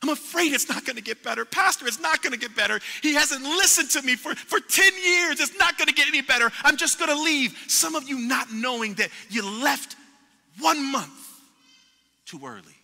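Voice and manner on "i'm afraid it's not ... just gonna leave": pitched-up, pleading complaint to the pastor; frustrated church member near tears, pitched high; despairing churchgoer. Retold or imitated. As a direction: whine that it will never get better.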